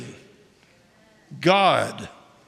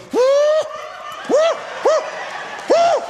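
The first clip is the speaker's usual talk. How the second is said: church whoop. Retold or imitated. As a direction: whoop like a shouting church lady. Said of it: "chicken woman jump up and go".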